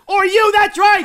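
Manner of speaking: excited high, loud yell; overexcited clown yelling; shouting. Acted. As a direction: shout it out, thrilled to get it right. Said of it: "why are you shouting".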